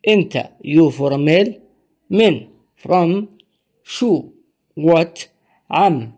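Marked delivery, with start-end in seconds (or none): none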